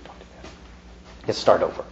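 put-on parent's hand on the child, whispered low warning; quiet warning. Shown as a demonstration. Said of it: "put my hand on you and say".

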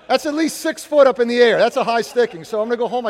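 playful loud complaint; mock protest. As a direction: argue the call with the referee, jokingly. Mock protest over hockey foul.

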